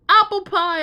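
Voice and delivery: high, loud delighted outburst; exclaiming. Acted. Flynn delighted to find apple pie, loud exclamation. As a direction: cry out in pure delight. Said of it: "Flynn exclaimed".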